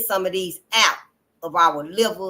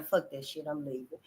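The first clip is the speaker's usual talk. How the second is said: muttered; 7 semitones lower, 14 dB quieter than usual. Retold or imitated. Voicing the sister walking out.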